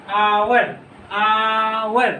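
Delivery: आवर with a long, stretched opening आ; drawn-out pronunciation. Shown as a demonstration. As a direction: say आवर slowly, holding the आ before वर. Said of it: "आवर पढ़ते हो आप इसे".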